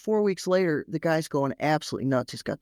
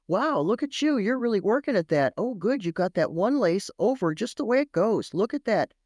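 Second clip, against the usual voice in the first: put-on higher, animated praise for a small child; encouraging praise; 5 semitones higher than usual. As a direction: cheer on a child's effort. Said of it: "don't wait for the finished product to praise".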